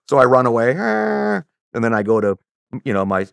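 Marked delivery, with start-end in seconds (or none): 0.8-1.4 high mock wail while fleeing; drawn-out high cry, acted. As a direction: let out a high "aah" as if running off. Imitates himself running away.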